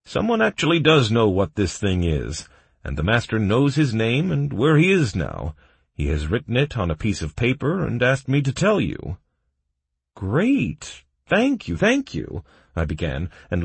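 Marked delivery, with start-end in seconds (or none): 0.0-9.2 higher, slightly louder cheerful report; happy messenger; happily informing. A narrator's reading. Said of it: "the guard happily informed me". 10.1-12.4 high-pitched, eager gratitude; breaking into excited relief; overjoyed thanks. A narrator's reading.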